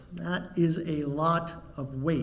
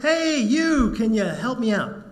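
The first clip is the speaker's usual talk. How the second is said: higher, louder call for help; shouting up; 5 semitones higher, 4 dB louder than usual. Acted. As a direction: call up to a passerby out of a hole. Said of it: "the guy shouts up".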